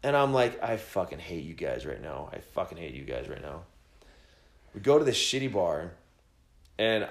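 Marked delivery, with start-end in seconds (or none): 0.6-3.6 low, soft grumble under the breath; low muttered mock hate; grumbling. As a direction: mutter it in disgust. Annoyed driver muttering.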